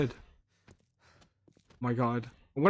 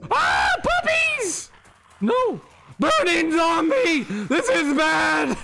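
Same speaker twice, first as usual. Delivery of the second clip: panicked yelling; 6 semitones higher, slower than usual. Real, not acted. Attacked by burning zombie.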